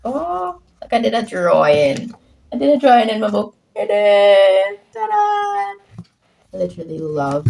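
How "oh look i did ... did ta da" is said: excited show-off. Real, not acted. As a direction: show off your drawing. Showing off her drawing.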